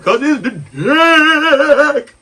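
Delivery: slow, drawn-out cocky answer, breaking into laughs; smug reply. Retold or imitated. Answering her mid-sex, slow smug laughing reply. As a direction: lean back and own it, laughing. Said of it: "And I'm like".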